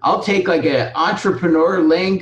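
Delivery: hammed-up quiz show pick; game show contestant. Jeopardy-style joke answer.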